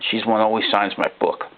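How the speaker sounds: ordinary delivery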